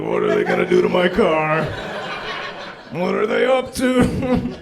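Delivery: high, loud worried driver; exaggerated anxious questioning; mock worry. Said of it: "very afraid of these kids".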